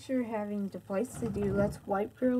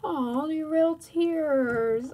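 slow, louder drawn-out cooing; stretched-out sympathetic baby talk; mock amazed sympathy; 5 semitones higher, 5 dB louder, much slower than usual. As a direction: marvel tenderly at the tears. Dad soothes child in pretend play.

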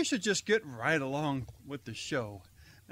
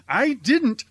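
loud protest, pitched up high; exasperated shout of innocence; indignant denial; 9 semitones higher, 12 dB louder than usual. Acted. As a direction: insist hard that you didn't do it. Denying accusation in skit.

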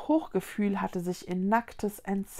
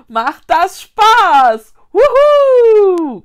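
very high, loud, drawn-out whoop; shrieked with joy; 11 semitones higher, 17 dB louder, much slower than usual. A narrator's reading. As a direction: scream it out like on a rollercoaster. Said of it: "kreischte Linus".

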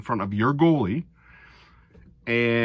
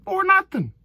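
pitched way high, getting louder; high, eager fan voice, louder on the second "Four nothing"; giddy fan hope; 5 dB louder than usual. Retold or imitated. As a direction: dream out loud of a rout. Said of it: "You're thinking".

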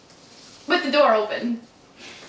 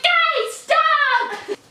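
high, exasperated mock mom; mom scolding; 3 dB louder than usual. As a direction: imitate mom begging the kids to quit. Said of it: "And my mom would be like".

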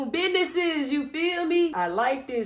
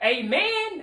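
shouted high amen; big hype amen; loud amen; 8 semitones higher, 7 dB louder than usual. Real, not acted. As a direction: call out amen like church. Hype reply to shirt slogan.

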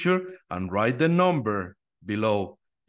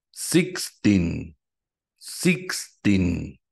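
counting drill; much slower than usual. Shown as a demonstration. Teacher models counting drill.

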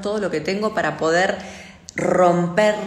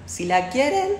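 ordinary talk

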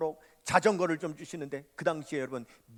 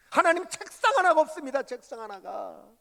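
lament; 9 semitones higher, 5 dB louder than usual. Retold or imitated. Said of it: "하나님 앞에 불평이 나오고".

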